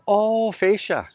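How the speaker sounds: put-on "fascia" drawn out slowly and high, in the roofer's Filipino accent. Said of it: "roofer's accented fascia".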